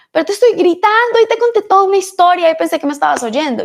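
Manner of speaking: imitating mom's yell. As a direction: play the exasperated mother, pitch up.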